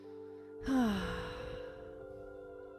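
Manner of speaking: long, soft exhaled sigh. Real, not acted. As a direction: let the breath out slowly in a sigh. Settling into meditation, audible exhale.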